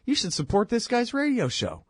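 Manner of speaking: playful mock plea to a boss who hates government; mock pitch to boss. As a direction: talk the boss into it, eager and high. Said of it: "Maybe you should say to your boss".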